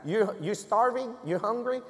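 lightly voiced teasing friend. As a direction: tease him about being hungry. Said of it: "él me decía".

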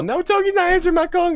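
very high squeaky cartoon clown. Fan imitating a rock and roll clown in a high squeak.

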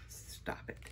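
a soft voiceless hiss of a scold; whispered scolding. Real, not acted. Scolding someone off-camera during the haul, whisper. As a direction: tell them off in a whisper.

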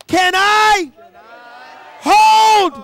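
high, loud shout; shouted plea, high and strong; screamed prayer. Shown as a demonstration. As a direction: scream it up to heaven. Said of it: "I want you to scream it".

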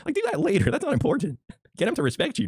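exasperated squeak. Real, not acted. Exasperated lecture.

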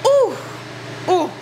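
high, loud delighted ooh; excited ooh. Real, not acted. Son admiring mom's soup, delighted squeal.

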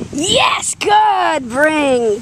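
ordinary talk

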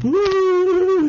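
high wailing sob, imitated; mock blubbering sob. Son bawling at mom's birthday call, high mock sob. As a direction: wail it like a man bawling on the phone. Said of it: "And I'm blubbering".

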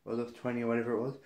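ordinary delivery

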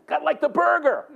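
high, hammed-up exclamation; excited, retold. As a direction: blurt it out high in mock amazement. Excited reply to healed man.

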